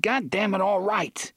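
angry giving-in. Acted. Eddie gives in angrily.

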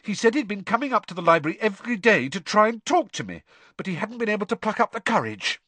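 rushed, high-pitched admission; flustered, fast confession; very quick. A narrator's reading. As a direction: blurt it out in a hurry. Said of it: "Hermione said this very quickly".

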